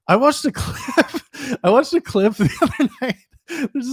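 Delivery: high, breaking up with real laughter; laughing while talking. Real, not acted. Host recalling a funny podcast clip, laughing through the words. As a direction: try to tell it through giggles.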